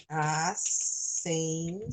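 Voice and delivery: slow, soft syllable by syllable; drawn-out dictation. Real, not acted. Teacher writing the correct word on the board, drawn out softly. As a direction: say it slowly as you write it.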